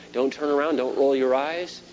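ordinary talk

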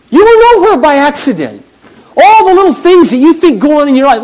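high, loud pulpit delivery; preaching at full force. Acted. Pastor preaching to his church, full force. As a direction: drive it to the congregation.

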